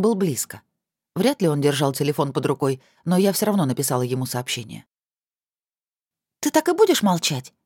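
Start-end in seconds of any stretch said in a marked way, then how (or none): 6.4-7.5 sharp, high, irritated demand. Acted. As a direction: snap it out, annoyed. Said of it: "не скрывала своего раздражения".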